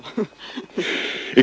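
light airy laugh of relief; a soft unvoiced chuckle; breathy laugh. Man laughing softly with Marion, relieved. Happy laugh after reconciliation.

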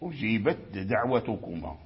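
أجيبت with its ت clearly voiceless and separate before دعوتكما; clear ta before dal. Shown as a demonstration. Teacher showing students the clear ta in أجيبت before دعوتكما. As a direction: sound the ت in أجيبت crisply, then a light د. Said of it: "فأظهر التاء عند الدال".

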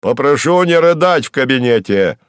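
loud, testy official reprimand; growing irritation. Acted. Suit rebuking the weeping secretary, angry. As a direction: order it loudly, losing patience. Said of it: "уже злясь, сказал вспыльчивый костюм".

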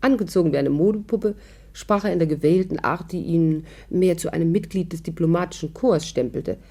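steady storytelling voice recounting the evening; story narration. A narrator's reading. [name] narrating to the listeners, in a storytelling voice. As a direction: tell it like a radio narrator looking back.

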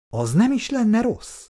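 high, lingering note of sudden interest; light up at the prospect, slowly savouring it; perking up with interest. A narrator's reading. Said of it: "csillant föl Laci szeme". (felcsillanó érdeklődéssel)

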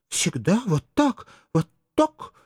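put-on a child squeezing her mother tight and promising; eager little girl. A narrator's reading.

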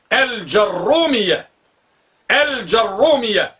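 الجرومية with a heavy doubled rr, slowly; teacher models the stressed r; slow demo, doubled r. Shown as a demonstration. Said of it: "راء مثقلة".

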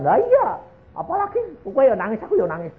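high, hurried mock-tearful babble; imitating weeping guests. Guests admit they are all crying, mock weepy squeal. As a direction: start very high and squeaky, then ease down. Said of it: "Itu lihat nangis kabeh".

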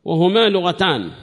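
loud, high-pitched recitation of a book; formal text reading. Acted. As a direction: read the text out loudly to the class. Reads the commentary text aloud.

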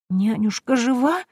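high, slow, tremulous hope; girl hears her nanny lives, close to tears; tearful child. A narrator's reading. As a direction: near tears, a small girl asks. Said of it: "В голосе малышки зазвучали слёзы".

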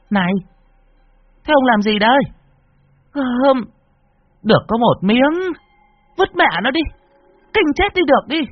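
a shrill-voiced wife begging him to throw it away; alarmed wife. Wife protests from the doorway. (giọng bà vợ rất cao, kêu ầm lên)

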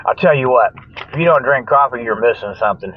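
usual speaking voice

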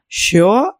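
sudden sharp cry of disbelief; shocked cry. Acted. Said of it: "скрикнув Тоні".

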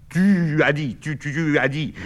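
indignant stammer, repeating tu; slight stutter. Acted. Bernard sputtering back at Olivier's order, stuttering. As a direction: trip over tu as he protests. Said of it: "il bégaye légèrement".

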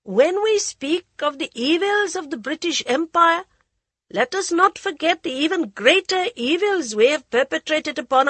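high, loud speech to a crowd; high goading voice. Acted. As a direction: rouse the crowd, high-pitched. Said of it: "The high voice continued to goad them".

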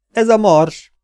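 high, loud cry of recognition; captain cries out as the planet appears; excited exclamation. A narrator's reading. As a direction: burst out in amazement at the sight. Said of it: "kiáltott fel Zénó kapitány".

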